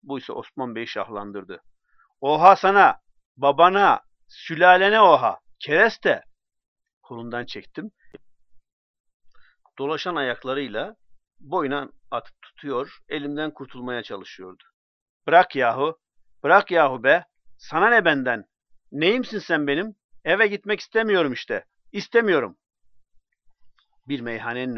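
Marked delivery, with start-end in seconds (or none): 2.2-6.2 higher and much louder furious yelling; drunken bellowed insults. Acted. Drunk Osman Bey yelling back at mocking onlookers, furious. 15.3-22.6 higher and louder, building to a whiny yell; angry drunken refusal. Acted. Drunk man shouting at friend who holds his arm, angry.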